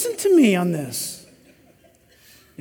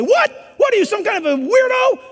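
shrill high mock disbelief, much higher; mocking incredulous peer; 8 semitones higher than usual. Friend mocking a chaste guy, high incredulous. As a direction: sneer at the weird one in a high voice. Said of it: "If they say".

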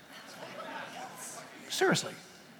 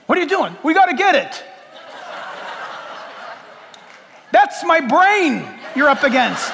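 laughing protest; 7 semitones higher, 4 dB louder than usual. Acted. Acting out getting covid test.

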